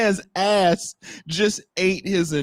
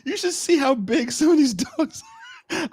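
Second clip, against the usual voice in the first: ordinary talk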